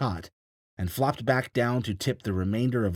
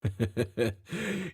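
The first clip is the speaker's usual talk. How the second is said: pained chuckle; 3 semitones higher than usual. A narrator's reading. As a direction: chuckle despite the hurt. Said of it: "he began to chuckle".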